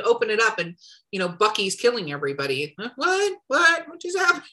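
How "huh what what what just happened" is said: confused. Retold or imitated. Confused reader of wrong genre.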